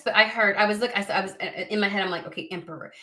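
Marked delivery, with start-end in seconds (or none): 2.3-2.9 hushed, softer 'Okay, Emperor'; low-key inner acknowledgement; quiet inner voice. Retold or imitated. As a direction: murmur it as a thought in your head. Inner voice in her head.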